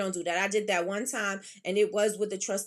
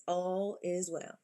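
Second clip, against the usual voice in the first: slow, softer reassuring repeat of "All is well"; cousin's saying; 5 dB quieter, much slower than usual. Retold or imitated. Quoting her cousin to comfort Michi, slow and soft. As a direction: let "All is well" settle in slowly and softly. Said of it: "like cousin says".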